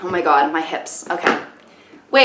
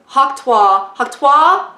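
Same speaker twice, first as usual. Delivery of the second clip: puzzled, faintly French questioning; French-person attempt; 5 semitones higher, much slower than usual. Real, not acted. Trying out American slang, faintly French accent. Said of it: "saying it like I'm, like, a French person".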